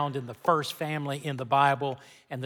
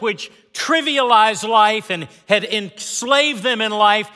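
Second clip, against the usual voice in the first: raised, rhythmic sermon delivery; preaching over music; 5 semitones higher, 6 dB louder than usual. Acted.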